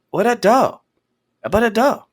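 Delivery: put-on little kid's garbled question; toddler voice. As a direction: imitate the baby asking for the dog. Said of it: "he's like".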